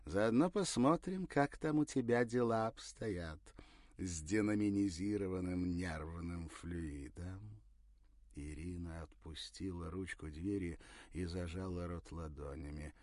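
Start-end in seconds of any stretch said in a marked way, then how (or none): 0.0-7.6 put-on sly old voice. Teasing voice continues.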